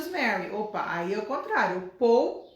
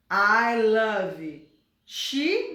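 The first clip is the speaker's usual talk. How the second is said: wrong form, very slow; 4 dB louder, much slower than usual. Shown as a demonstration. Teacher slowly voicing the mistake before correcting it. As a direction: drag out I love she with she rising. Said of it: "não".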